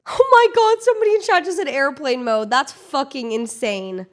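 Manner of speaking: loud, high burst of disbelief; shocked outburst. Real, not acted. Streamer reacting to a chat message, shocked outburst. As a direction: blurt it out at the top, easing down slightly.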